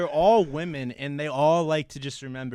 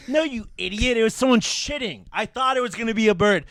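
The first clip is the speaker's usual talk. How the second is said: loud, higher-pitched retold tirade; lighting someone up; 6 semitones higher, 7 dB louder than usual. Telling off a coworker who asked, loud and indignant. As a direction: angrily snap back, loud and raised. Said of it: "I just lit the first person up".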